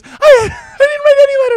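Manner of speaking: loud, very high squealing denial; Brendan. Acted. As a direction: protest desperately in a mock squeaky voice. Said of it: "his voice is high like this".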